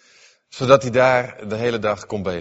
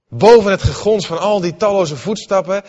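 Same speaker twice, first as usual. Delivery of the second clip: ordinary talk, nothing marked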